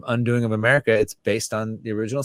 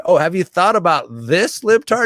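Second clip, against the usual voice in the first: mock condescending conservative; 7 semitones higher, 6 dB louder than usual. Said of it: "These libtards are".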